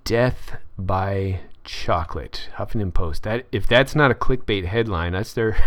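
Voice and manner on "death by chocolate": slow dramatic repeat. Shown as a demonstration. Mocking the clickbait headline, slow mock-ominous. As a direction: stretch out "Death by chocolate" slowly, like a movie trailer.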